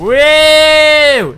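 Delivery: real triumphant woo; excited whoop. Real, not acted. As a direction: let out a big victory whoop. Celebrating in the game.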